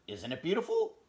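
high proud hedgehog. Acted. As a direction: show off the new hat. Hedgehog showing off hat.

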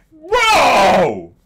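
high, loud, stretched cry of disbelief; outraged shout. Real, not acted. Fan yelling at the quarterback who stole the touchdown, outraged shout. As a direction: yell it out in outrage.

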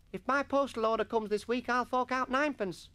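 put-on high-pitched boy offering grandly; generous schoolboy. A narrator's reading. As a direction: pledge it in a high boy's voice. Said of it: "said Bromwich generously".

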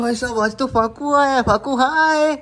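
high, loud delighted hello; light up as he arrives; greeting Faku with delight; excited greeting. Real, not acted.

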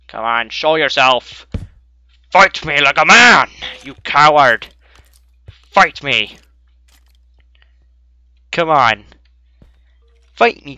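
shouted dare into the dark; taunting shout. Real, not acted. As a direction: call the coward out, daring him. Taunting a hidden skeleton to fight.

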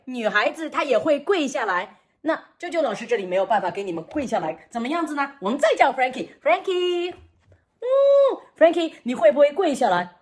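ordinary talk

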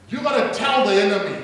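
normal talking voice

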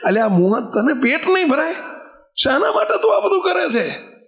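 high scolding challenge to a sinner; scolding rebuke. Retold or imitated. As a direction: scold him sharply from above. (ઊંચા સ્વરે ઠપકો)

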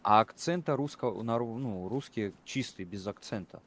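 ordinary talk

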